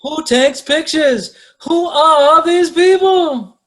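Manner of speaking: high, slow, drawn-out indignant cry; mock outrage. Host exclaiming at people who take pictures, mock outrage. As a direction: stretch each word in loud disbelief.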